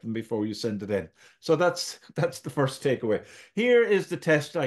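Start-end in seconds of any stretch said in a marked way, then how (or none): none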